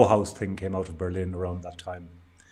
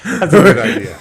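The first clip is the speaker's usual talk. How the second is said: laughing; 6 semitones higher, 8 dB louder than usual. Real, not acted. Laughing at skipped decade.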